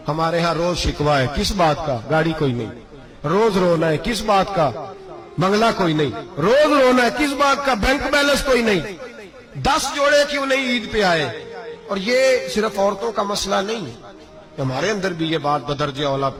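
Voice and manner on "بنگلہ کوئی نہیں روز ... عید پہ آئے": mocking complaint.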